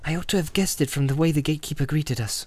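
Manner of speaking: Frodo, rueful. A narrator's reading. Frodo realizing to Pippin and Sam, rueful.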